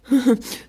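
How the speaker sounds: high, refined little laugh; elegant laugh. A narrator's reading. Peacock showing off to the girls, elegant chuckle. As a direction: laugh daintily, to be admired. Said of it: "Il eut un rire élégant".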